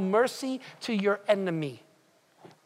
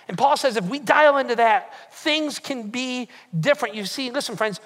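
normal talking voice

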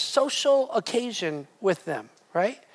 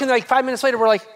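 ordinary talk, nothing marked

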